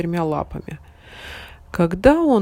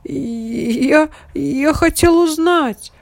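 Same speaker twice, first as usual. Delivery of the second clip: scared puppy; 8 semitones higher, 6 dB louder, much slower than usual. A narrator's reading. Puppy stammers.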